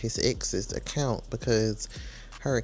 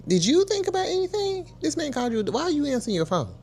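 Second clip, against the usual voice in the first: much higher, incredulous demand; mock outraged girlfriend; 14 semitones higher than usual. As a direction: squeal it at him in disbelief. Voicing angry girlfriend confronting him.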